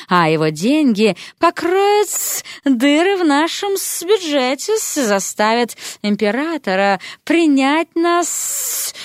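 slow, high, sly snake-woman drawl with hissed endings; hissing snake lady. Acted. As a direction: purr it out like a scheming serpent. Snake lady Serpentina speaks.

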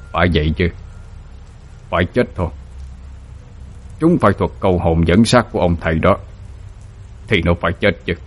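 quiet, scheming villain's voice; sinister gloating. A narrator's reading. Ông hai gloats over Tú's death, sinister. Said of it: "nở một nụ cười thâm hiểm mà nói".

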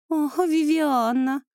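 high, slow, syrupy drawn-out call; sugary drawl. A narrator's reading. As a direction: pour on fake sweetness. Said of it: "елейным голосом протянула".

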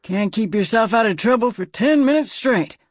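a grumbling reproach in character; gruff. A narrator's reading. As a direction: chide gruffly, pitch lifted. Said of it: "Slim observed gruffly".

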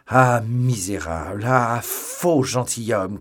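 outraged shout at the fleeing stranger; angry cry. Acted. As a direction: hurl each insult slowly after him. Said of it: "cria D'Artagnan".

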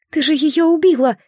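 high, lamenting cry; wailing. A narrator's reading. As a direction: wail it out in grief. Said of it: "причитала Матика".

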